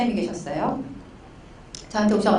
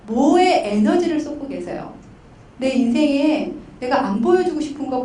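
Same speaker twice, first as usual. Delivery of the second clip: ordinary delivery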